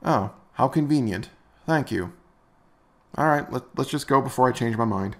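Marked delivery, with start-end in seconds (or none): none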